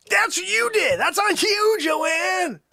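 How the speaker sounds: high, shouted comic reproach; mock scolding. Host blames Joanne for going back, high mock scolding.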